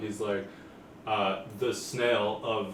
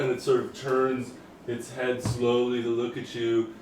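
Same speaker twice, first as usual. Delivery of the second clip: ordinary talk, nothing marked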